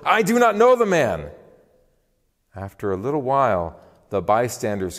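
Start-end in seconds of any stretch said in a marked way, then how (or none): 0.0-1.3 high, loud, emphatic protest; sworn denial. A narrator's reading. Peter denying Jesus with an oath, vehement protest. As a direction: swear it with raised pitch and force. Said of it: "he denied it with an oath".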